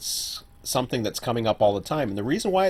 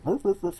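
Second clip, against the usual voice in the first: dog bark imitation; 10 semitones higher than usual. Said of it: "I'll go".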